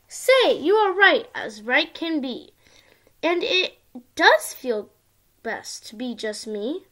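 sing-song storybook reading; reading to a toddler. Acted. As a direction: read it like a bedtime picture book. Child reads picture book aloud.

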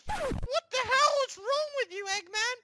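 high, loud cartoon voice; squeaky indignant outburst; exasperated shout. Link confronts raging Eggman.

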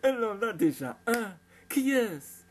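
loud, high teasing excitement; cried out. A narrator's reading. Brother guessing his sister has someone new, crying out excitedly. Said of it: "s'écria Zach".

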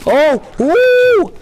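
high, loud gamer whoop; excited cheer. Real, not acted. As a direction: cheer the play at full volume.

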